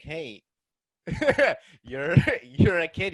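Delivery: laughing while quoting himself; chuckling through the words. Retold or imitated. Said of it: "I'd be like".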